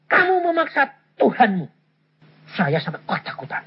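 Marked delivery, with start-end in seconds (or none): 0.1-1.7 higher, sharper voice of an angry God; a hint of God rebuking like thunder; thunderous voice of God. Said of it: "Suaranya kayak guntur". (suara Tuhan yang marah seperti guntur, sedikit ditirukan)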